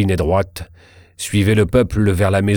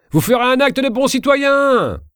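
crowd shout. A narrator's reading. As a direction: shout it up at the officer. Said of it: "criait le peuple". (appel crié de la foule, très aigu)

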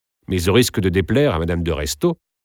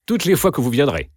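higher, hurried cut-in; hasty interjection; 8 semitones higher than usual. A narrator's reading. Countess cutting off Eugène's parting request, hasty interjection. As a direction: jump in before he can finish. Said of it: "dit précipitamment la comtesse".

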